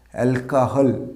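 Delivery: অ্যালকোহল as "al-ka-hal"; corrected pronunciation. Shown as a demonstration. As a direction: say অ্যালকোহল with an open "ka". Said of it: "এর সঠিক উচ্চারণটা হবে". (সঠিক অ্যালকোহল, "অ্যাল-কা-হাল")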